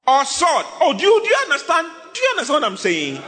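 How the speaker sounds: high, loud and fast; fervent shouting preacher; excited shout. Real, not acted. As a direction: exclaim at the crowd.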